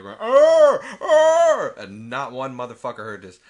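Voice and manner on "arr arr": very loud, high imitated dinosaur roar; T-Rex roar. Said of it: "damn T-Rex going apeshit in his container".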